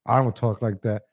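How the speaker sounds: normal talking voice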